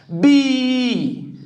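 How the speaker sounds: bíceps broken into three slow, separate syllables; bíceps wrongly split. Shown as a demonstration. Teacher showing the wrong division, bíceps in three pieces. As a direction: say bí, cê, pis with long gaps. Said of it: "Eu não posso separar assim".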